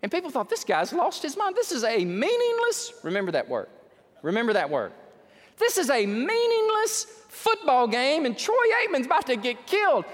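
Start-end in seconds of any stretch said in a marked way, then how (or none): 0.5-2.9 high-pitched disbelieving outcry; incredulous onlookers. Acted. Preacher voices the crowd watching a wild run, incredulous. 5.6-10.0 loud, high, hammering exclamation; incredulous outcry. Acted. Preacher voices fans watching a reckless touchdown run, incredulous outcry. Acted onlookers' outcry at game.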